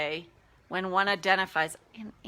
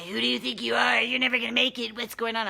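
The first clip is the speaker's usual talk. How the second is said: a hint of a sneering inner critic; inner bully voice; 3 semitones higher, faster than usual. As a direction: lightly voice the nagging bully. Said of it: "that voice chattering away".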